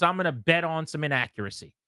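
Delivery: ordinary talk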